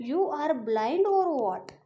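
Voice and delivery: mock-indignant outburst at the shopkeeper; angry, loud. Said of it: "with loud voice I am saying".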